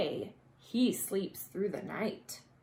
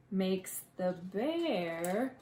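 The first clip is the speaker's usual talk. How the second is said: plain reading voice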